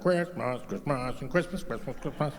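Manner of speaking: put-on slurred, muttered drunken babble; drunk dad. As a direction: play a tipsy dad slurring one word over and over. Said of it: "my dad's kinda drunk".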